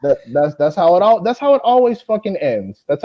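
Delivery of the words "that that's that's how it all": ordinary talk, nothing marked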